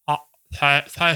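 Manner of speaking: slurred jaw-locked mumble; can't shape the words, jaw stuck; dislocated jaw voice. Shown as a demonstration. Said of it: "that's what I was like".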